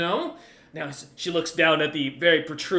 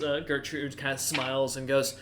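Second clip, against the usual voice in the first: ordinary delivery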